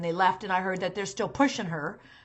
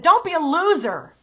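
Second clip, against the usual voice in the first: mock harsh father; 6 semitones higher, 8 dB louder than usual. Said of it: "and he said".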